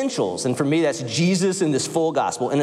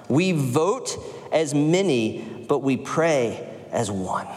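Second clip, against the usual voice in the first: slow solemn; much slower than usual. Retold or imitated. Quoting the concession speech climax.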